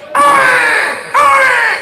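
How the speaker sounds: shrill high mock baby crying "ah, ah"; hammed-up newborn cry; imitated baby wail.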